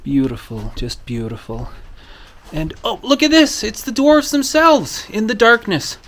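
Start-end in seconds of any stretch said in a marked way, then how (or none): 2.5-6.0 much higher and louder, thrilled; excited. Real, not acted. Showing viewers the dwarfs in the mine, excited. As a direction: burst out with delight at the dwarfs.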